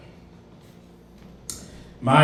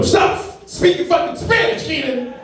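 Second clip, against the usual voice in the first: angry coworker; 14 semitones higher than usual. Retold or imitated. Coworker snaps at him.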